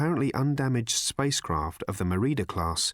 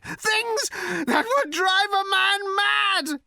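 very high, loud, drawn-out shout; frenzied shouting; 4 dB louder, much slower than usual. A narrator's reading. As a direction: shout it wildly, stretching every word. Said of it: "he shouted".

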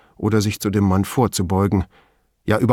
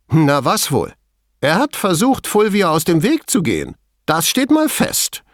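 high-pitched, emphatic point-scoring; triumphant assertion; 9 semitones higher, 4 dB louder than usual. A narrator's reading. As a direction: play it like a trump card. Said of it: "trumpfte Veltrini auf". (auftrumpfend, mit hoher Stimme)

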